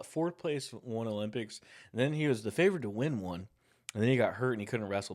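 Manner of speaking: ordinary talk, nothing marked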